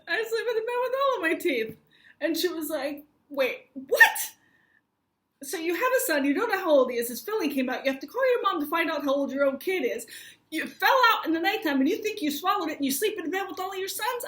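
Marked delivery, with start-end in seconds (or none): 0.1-1.8 high, loud, laughing protest; hammed-up squeal of disbelief; incredulous retold answer. 3.3-14.2 loud, high, incredulous recap; hammed-up flabbergasted receptionist; acted-out disbelief. Acts out baffled receptionist's recap.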